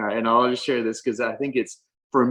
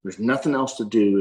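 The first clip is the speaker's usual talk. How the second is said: ordinary talk, nothing marked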